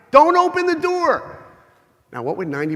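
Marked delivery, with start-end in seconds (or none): 0.1-1.4 high, loud, slow frantic scream from inside; wife's scream. Retold or imitated. Wife screaming through the door to stop her husband coming in. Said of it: "his wife screams from inside".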